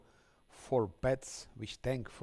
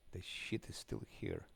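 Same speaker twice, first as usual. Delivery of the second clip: whispered grumble; 8 dB quieter than usual. Real, not acted.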